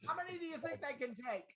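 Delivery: high, soft, quick imitation of a veteran mezzo. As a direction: toss out the teasing question high and lightly. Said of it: "She says".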